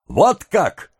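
high, stung retort; wounded, high-pitched outcry; bitter exclamation. A narrator's reading. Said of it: "с горечью воскликнула девушка".

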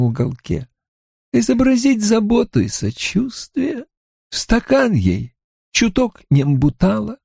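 calm, relishing serial killer; lightly voiced killer Creed. A narrator's reading. (слегка озвученный маньяк Крид)

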